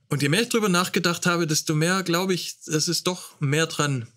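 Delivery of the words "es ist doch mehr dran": usual speaking voice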